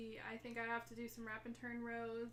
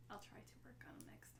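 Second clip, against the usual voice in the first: fast soft whisper; 2 semitones lower, 13 dB quieter, faster than usual. Real, not acted. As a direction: mutter it under your breath. Whispered aside.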